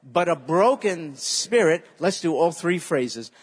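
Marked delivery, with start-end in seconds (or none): 0.0-1.8 slow, loud, each word leaned on; a slow chanted recitation for the church to repeat; slow call-and-response. Shown as a demonstration. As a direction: lead the congregation line by line. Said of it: "say that".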